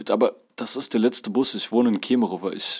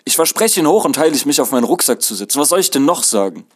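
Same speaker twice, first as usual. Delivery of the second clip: raised, higher exasperated voice; loud desperate pleading; 5 semitones higher, 5 dB louder than usual. A narrator's reading. Muddy passenger begs conductor for a ride, pleading loudly. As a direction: plead your case loudly, at wit's end.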